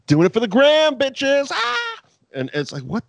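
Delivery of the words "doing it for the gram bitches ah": high, squealing fake cheer; mock a smiling interviewer who ignores the danger; shrill hammed-up influencer squeal; mock cheerful interviewer. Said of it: "being like".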